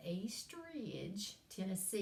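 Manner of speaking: drawn-out East Tennessee drawl; East Tennessee accent. Shown as a demonstration. Professor introducing her hometown to students, East Tennessee drawl. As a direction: stretch it out slowly in East Tennessee twang. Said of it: "'cause that's how we talk in East Ridge".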